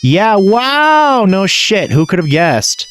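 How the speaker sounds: drawn-out high mock wow; sarcastic awe at the twist. Real, not acted. Gamer reacting to plot twist with sarcastic high wow.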